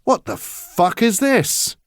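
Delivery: high, slow, baffled and swearing; confused outrage. A narrator's reading. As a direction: demand it, thrown by the sight. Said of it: "his face screwed up in confusion".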